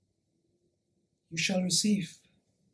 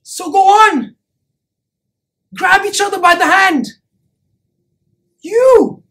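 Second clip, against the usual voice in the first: loud, high call to the crowd; rousing command; urgent exhortation; 5 semitones higher, 7 dB louder than usual. Acted. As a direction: urge them on, voice raised. Urging the crowd to join hands.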